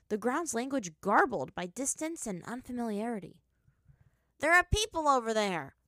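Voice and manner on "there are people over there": loud, high excited cry; excited exclaim. A narrator's reading. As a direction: call it out, thrilled. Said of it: "Spirit Toph exclaimed".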